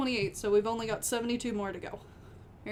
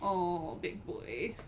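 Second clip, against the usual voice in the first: normal talking voice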